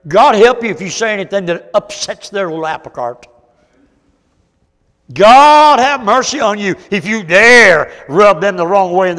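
loud, high preacher's outburst; full-force preaching. Acted. Preacher arguing back at offended church members, full-force preaching. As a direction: thunder it out at the congregation.

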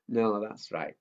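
usual speaking voice

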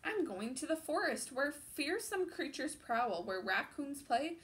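put-on animated children's book narration; picture book read-aloud. A narrator's reading. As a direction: read it out like storytime for little ones.

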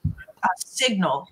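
ordinary delivery

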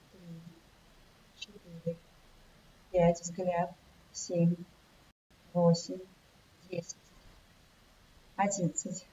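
slow, measured counting of breath holds. Acted. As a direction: count each beat slowly and evenly. Instructor counts breathing exercise.

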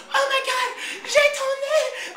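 squeaky high falsetto in fake French, slow and laughing; mock French porn line. A vlogger mocking French porn dialogue, in a slow, squeaky falsetto. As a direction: ham up a breathy-sounding French starlet, drawn out. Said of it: "they're saying all this in French".

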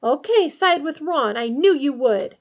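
loud, high, aggrieved outburst; shrill. A narrator's reading. Hermione losing her temper with Harry, shrill. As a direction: snap back shrilly, full of hurt. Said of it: "she said shrilly".

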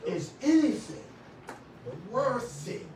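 usual speaking voice

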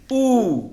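a long ऊ sound. Shown as a demonstration. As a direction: draw out the उ like उसाचं. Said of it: "उसाचं याचा उच्चार लांब करायचा".

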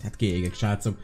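mélyebben, halkan a nézőknek; súgd oda a nézőknek; halk félreszólás. Real, not acted. (halk, mély kiszólás)

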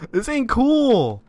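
ordinary talk

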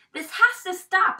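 put-on a child finally standing up to a worry; firm declaration. A narrator's reading. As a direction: put your foot down and announce it. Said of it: "I declared".

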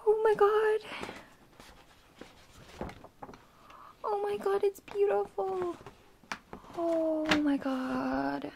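high, slow squeal of real awe; overwhelmed awe. Real, not acted. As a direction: gush in a high, drawn-out voice, overcome. Overwhelmed on unboxing the album.

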